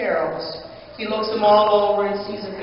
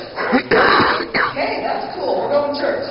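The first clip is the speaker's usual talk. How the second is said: high, excited whisper; excited sparrows; 5 semitones higher, 4 dB louder, much slower than usual. Acted. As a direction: whisper it, thrilled to be going. Sparrows excited about church.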